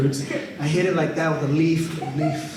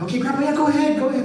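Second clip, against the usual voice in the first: easygoing reply; 10 semitones higher, faster than usual. Retold or imitated. Grandson letting grandpa go.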